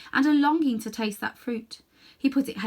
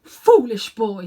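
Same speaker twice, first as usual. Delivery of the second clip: witch; 12 dB louder, slower than usual. Acted. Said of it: "said the witch".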